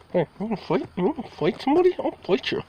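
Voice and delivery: high, teasing voice daring the cat to fight; playful cat taunt. Real, not acted. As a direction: squeak a mock challenge at the cat.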